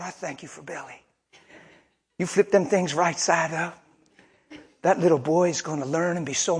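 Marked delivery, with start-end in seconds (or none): 0.0-1.0 soft, murmured thank-you to God; hushed prayer. Acted. Mother's soft prayer quoted.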